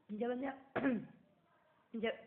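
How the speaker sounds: words broken up by a real cough; bad throat, coughing. Real, not acted. Teacher restarting her explanation with a bad throat, coughing. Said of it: "sorry, थोड़ा सा गला खराब है".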